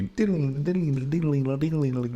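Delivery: high, tinkling sing-song sound effect; imitated fairy motif. Comedian mimics a fairy character's entrance sound, airy tinkling imitation. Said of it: "airy fairy character, it would just be".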